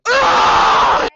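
loud high-pitched shriek of annoyance; shrieked ugh. Real, not acted. Caught on camera, she shrieks in annoyance. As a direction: let out a piercing fed-up ugh. Friend keeps filming.

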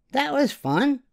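put-on very high, bright child voice; giddy girl after the ride; little girl Yvonne.

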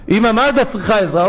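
butter up his mother; eager sweet son. Retold or imitated.

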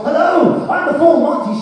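high, loud mock announcement; a showy doorstep entrance, pitched high; hammed-up grand entrance. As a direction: burst in with a high showman's hello. Comic arriving at a doorstep.

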